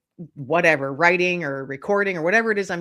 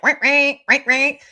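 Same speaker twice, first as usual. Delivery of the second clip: impatient little birds; 7 semitones higher, 7 dB louder than usual. Pretend. Birds voiced demanding food.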